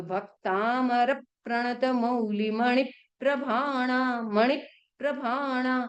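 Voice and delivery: slow, each half-consonant joined in भक्तामर प्रणत; careful slow recitation. Shown as a demonstration. Teacher corrects the student, reciting slowly and carefully. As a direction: spell out the verse slowly with joined clusters. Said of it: "पीछे प और र आ रहा है".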